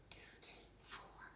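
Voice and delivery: unvoiced whispered counting. Real, not acted. Reader counting the bug lines in a hushed whisper. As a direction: count under your breath, secretly.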